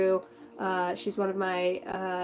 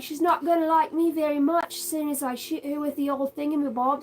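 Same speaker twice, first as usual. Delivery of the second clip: imitating the nurse's Australian accent, pitched higher; a light mock Australian nurse, higher up; 7 semitones higher than usual. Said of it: "I don't know if this is an Australian accent".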